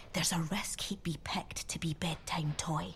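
soft secretive whisper; conspiratorial whisper. Acted. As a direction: lean in and whisper the risk. Car warns the other toys.

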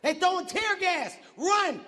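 high, drawn-out warning cry; retold yell. Protester repeating the tear gas warning, high mock yell. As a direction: imitate an alarm called across a crowd. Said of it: "somebody yelled out".

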